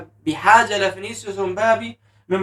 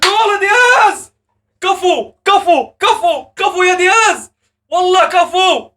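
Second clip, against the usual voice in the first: goal celebration; 8 semitones higher, slower than usual. Real, not acted. Fan celebrating Diaz's goal, high ecstatic shouting. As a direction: yell the scorer's name in triumph.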